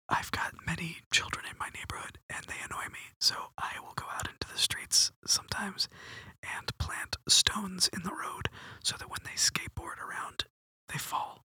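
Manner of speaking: hushed, halting confessional whisper; whispered secret. Pretend. Host confesses a secret to little man, whispered. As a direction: whisper it with pauses, like a shameful secret.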